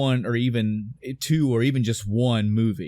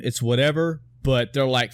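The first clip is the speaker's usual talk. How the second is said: ordinary talk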